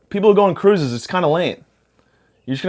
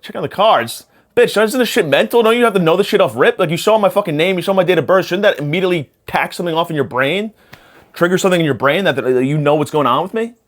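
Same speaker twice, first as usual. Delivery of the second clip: high-pitched, incredulous mock rant; worked-up rant, pitched up and building, then easing slightly; indignant retort; 6 semitones higher than usual. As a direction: fire back at the psychic in exasperated disbelief. Acted indignant retort to psychic.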